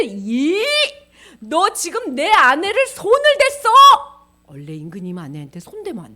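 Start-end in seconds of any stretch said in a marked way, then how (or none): 0.0-3.7 loud, high, outraged king; angry shouting. Acted. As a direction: bellow slowly in acted fury. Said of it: "화를 소리 소리 지르니까". (화나서 소리치는 임금)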